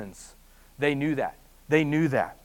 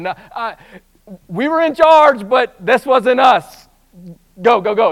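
higher, louder hammed-up protest; mock panicked herdsmen; 5 semitones higher, 9 dB louder than usual. Herdsmen running to town blame others, mock panicked shout. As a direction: shout it out while running away, half in fun.

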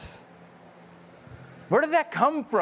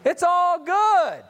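high, loud, delighted proclamation that all food is good; joyful Jesus; 9 semitones higher, 4 dB louder, slower than usual. Retold or imitated. Preacher voicing Jesus, loudly thrilled that bacon is good. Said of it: "Jesus said".

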